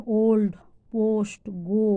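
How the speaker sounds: ওল্ড, পোস্ট, গো with a round "oh". Shown as a demonstration. Teacher giving examples of O, round "oh" in ওল্ড, পোস্ট, গো. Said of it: "আর ও যখন হয়".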